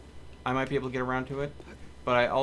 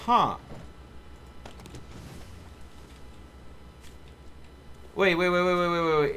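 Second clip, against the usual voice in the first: sudden realization; 4 semitones higher than usual. Real, not acted. Streamer suddenly recognizing the robot game. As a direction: stop everyone, it just clicked.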